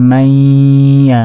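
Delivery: "মাইয়া" with a long nasal hold before ya; nasalised ghunnah. Shown as a demonstration. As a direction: slowly hum through "মাই" into "য়া". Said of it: "গুন্না করে পড়তে হবে". ("মাইয়া" গুন্না করে টেনে)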